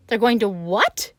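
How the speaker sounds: high, loud shocked scream. A narrator's reading. Pig Wilbur hears he will be killed, shrieking in shock. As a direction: shriek it as the news lands. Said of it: "screamed Wilbur".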